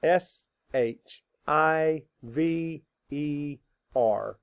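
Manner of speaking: letters spelled out slowly; slow spelling. Shown as a demonstration. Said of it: "Spell it".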